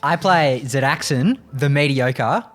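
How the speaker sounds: ordinary delivery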